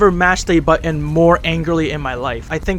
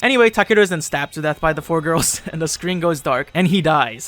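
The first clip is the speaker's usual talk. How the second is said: ordinary talk, nothing marked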